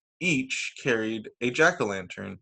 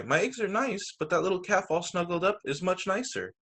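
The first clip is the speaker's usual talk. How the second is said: near tears; 6 semitones higher, 3 dB quieter than usual. A narrator's reading. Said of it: "Dora wanted to cry".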